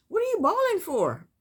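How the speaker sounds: ordinary talk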